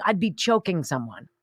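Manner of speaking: ordinary talk, nothing marked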